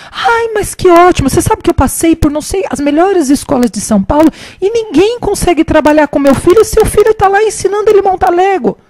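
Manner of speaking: high, loud, hammed-up amazement; loud gushing mother. Mother marvelling at the boy helping her son, loud mock gushing. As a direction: imitate a mother gushing for all to hear. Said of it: "bem alto".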